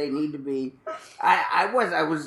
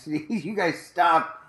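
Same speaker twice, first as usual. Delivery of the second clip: ordinary delivery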